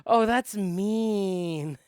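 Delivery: loud, high, very slow stretched-out tease; mock outrage. Real, not acted. Player teasing the GM over a cruel trick. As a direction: drag it out in delighted disapproval.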